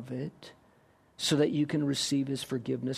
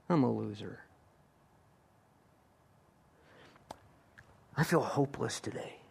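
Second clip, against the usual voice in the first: defeated inner thought; 5 semitones lower, 7 dB quieter than usual. Retold or imitated. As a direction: lower and softer, resigned agreement. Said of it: "you'll just think".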